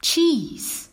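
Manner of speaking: a sudden high cry of the word; eager cry. Acted. Lamb tempting the coyote, a high eager cry. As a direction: pitch it way up like a bright idea. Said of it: "cried Ram".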